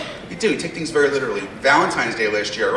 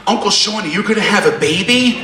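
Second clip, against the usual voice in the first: put-on higher, childlike question; little girl voice; 6 semitones higher than usual. Comic telling his five-year-old niece's question in a higher childlike voice. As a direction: mimic a five-year-old asking innocently. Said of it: "points at my stomach and goes".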